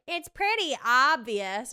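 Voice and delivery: loud, slow condescending; smug commenter. Retold or imitated. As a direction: drag it out, smug. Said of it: "other people are like".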